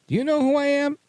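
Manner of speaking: high, loud "do you know who I am"; mock entitled demand. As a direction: snap it out as a pompous official. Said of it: "who says".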